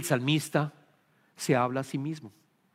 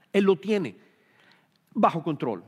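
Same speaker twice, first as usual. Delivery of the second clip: normal talking voice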